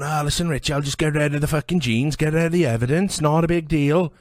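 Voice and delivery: high, loud mocking impression of a friend; mimicking a friend. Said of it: "And you were going".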